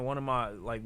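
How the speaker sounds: ordinary talk, nothing marked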